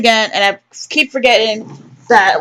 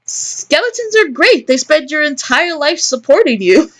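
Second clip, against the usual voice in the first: much higher, playful character voice; high-pitched joker character; 7 semitones higher than usual. Acted. Character cracking a skeleton joke, high playful voice. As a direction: pitch it way up and sell the pun.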